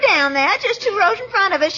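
ordinary talk

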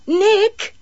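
high, loud call of his name. A narrator's reading. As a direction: call to him across the mud. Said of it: "she called".

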